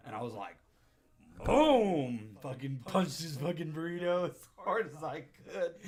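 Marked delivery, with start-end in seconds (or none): 1.5-5.7 booming shout breaking toward laughter; explosive re-enactment. Retold or imitated. Teller re-enacting punching the burrito, loud and explosive. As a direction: blast the first word, stay loud and high.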